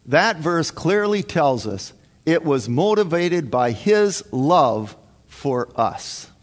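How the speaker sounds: normal talking voice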